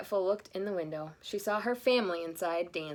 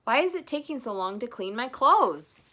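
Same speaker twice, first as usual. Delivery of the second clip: grumpy; 5 semitones higher, 7 dB louder than usual. Acted. Grumpy Santa complaining.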